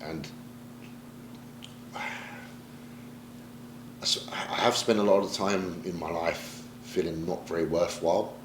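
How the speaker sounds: slow, breathy hushed confession, barely voiced; almost whispering a painful admission; near whisper. Real, not acted. Confessing feeling worthless, voice dropping.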